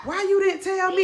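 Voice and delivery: high, loud demanding shout; the dad yelling for answers across the lot; a father confronting the mother in a parking lot, loud and high; frantic dad. Retold or imitated. Dad runs at mom in lot.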